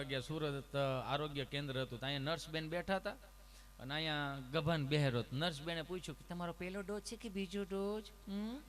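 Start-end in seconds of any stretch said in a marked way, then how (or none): 6.3-8.0 lightly voiced nurse, soft and kind; a hint of a sugary nurse; sweet nurse. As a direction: ask gently like a caring nurse. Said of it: "તમે કેટલું પ્રેમથી પૂછો છો".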